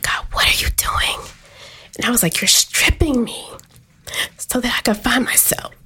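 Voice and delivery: whisper. Real, not acted. Tearful testimony, whispered quotes.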